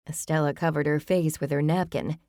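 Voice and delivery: ordinary delivery, nothing marked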